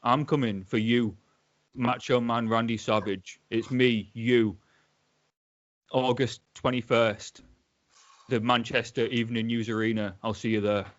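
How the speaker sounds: put-on a lightly done Manchester accent, wrestling promo cadence. A Hull guest doing British Bulldog's Manchester promo for the hosts. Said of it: "a guy from Hull doing a Manchester accent".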